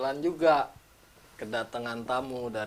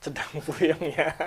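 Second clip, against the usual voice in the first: laughing; 4 semitones higher than usual. Real, not acted. Host laughs echoing guest's joke.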